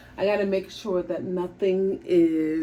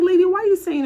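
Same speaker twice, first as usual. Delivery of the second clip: mock outraged, pitched up and louder; exasperated high protest; 13 semitones higher, 6 dB louder, much faster than usual. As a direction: call the lady out in disbelief.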